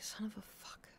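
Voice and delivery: under-breath whispered curse. Retold or imitated. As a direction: hiss it so they cannot hear. Frustrated whisper at neighbours.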